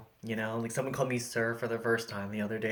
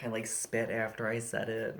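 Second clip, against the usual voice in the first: ordinary talk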